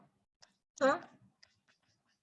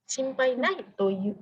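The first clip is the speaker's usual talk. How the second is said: slow, clear shinpai nai toyu; slow model answer; 3 semitones higher, much slower than usual. Shown as a demonstration. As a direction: model it slowly for the class. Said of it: "teacher models the answer slowly". (contohkan shinpai nai toyu dengan pelan)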